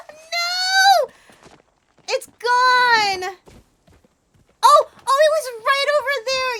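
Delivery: loud high cries of dismay; dismayed shouting. Real, not acted. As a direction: shout in disbelief. The creature escapes.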